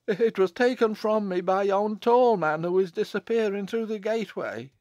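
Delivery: high, alarmed voice of a frightened underling; great trepidation. A narrator's reading. Man explaining the child's loss, in great trepidation. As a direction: blurt it out high and fearful. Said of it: "in great trepidation".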